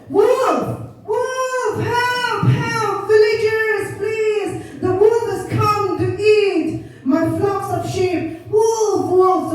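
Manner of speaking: high, drawn-out calls for help; mock shouting. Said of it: "And then he shouted".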